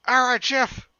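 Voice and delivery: high, loud call-back; yelled from afar. Acted. Lou yells to Jeff from the squad car, high and loud. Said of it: "Lou yelled from the back car".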